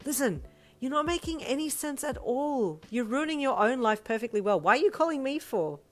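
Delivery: high, loud scolding rant; exasperated sister. A narrator's reading. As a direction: lecture in fed-up exasperation.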